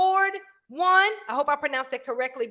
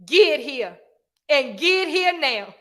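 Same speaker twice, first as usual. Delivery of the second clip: slow, falling "get here now"; stern demand; slower than usual. Shown as a demonstration. Parent demanding the phone instead of asking, stern demand. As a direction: order it firmly, drawing it out. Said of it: "when you start talking like that".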